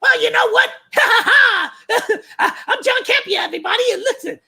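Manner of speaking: a giggly, squeaky soy-voiced host greeting the crowd; high giggling mock talk-show host; Campea impression. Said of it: "try his every damnedest to say".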